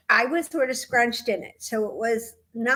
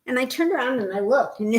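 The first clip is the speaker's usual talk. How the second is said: ordinary talk, nothing marked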